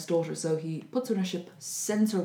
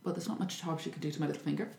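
whispered, quick inner thought; 2 semitones lower, 9 dB quieter, faster than usual. Retold or imitated. Daughter thinking at the keyhole, whispered and quick. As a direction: murmur it to herself quickly.

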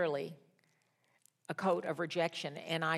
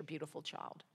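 fading; 4 semitones lower, 14 dB quieter than usual. Real, not acted. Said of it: "a beautiful child".

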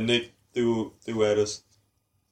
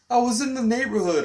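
much higher, innocently breezy; imitating mom; 13 semitones higher, 4 dB louder than usual. Grandma explaining surprise visit, mock innocent voice. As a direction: say it like a harmless excuse. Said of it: "there's my mom just".

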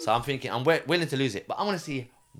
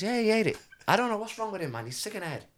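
woman; 10 semitones higher, faster than usual. Retold or imitated. Woman explaining man ate card.

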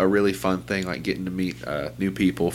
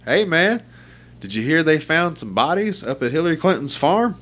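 excited coworker; 8 semitones higher than usual. Retold or imitated. Coworker at the computer tells him the news, high and excited. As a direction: play the wide-eyed coworker breathlessly sharing news. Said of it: "this guy was on the computer".

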